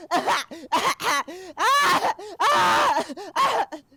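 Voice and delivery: very high, loud crying shriek; doomed creature shrieking at its master. Acted. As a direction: beg through choking sobs.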